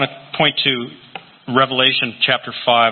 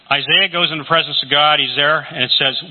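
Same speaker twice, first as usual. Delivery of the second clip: normal talking voice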